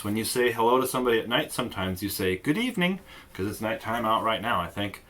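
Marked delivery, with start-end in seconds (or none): 2.4-3.0 greeting. Shown as a demonstration. Sample evening greeting.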